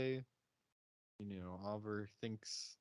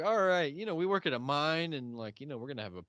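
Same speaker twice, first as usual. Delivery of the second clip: loud, high cheerful pitch; hammed-up eager miner; mock party bro; 7 semitones higher, 10 dB louder than usual. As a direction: hype up the mine party. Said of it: "but they're like".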